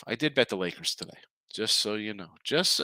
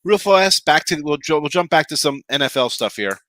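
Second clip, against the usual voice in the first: hyped transition; 4 semitones higher, 10 dB louder than usual. Real, not acted. Hyped segment switch.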